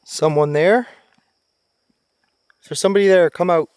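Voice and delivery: loud nervous challenge; calling out. Real, not acted. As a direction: call out to make them show themselves. Calling to unseen person.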